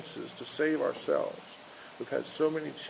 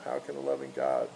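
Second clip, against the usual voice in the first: "How can a loving God?" repeated much lower and dragged; low mocking echo; 12 semitones lower than usual. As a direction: drop the pitch right down on "How can a loving God?", a skeptic's grumble. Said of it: "You know".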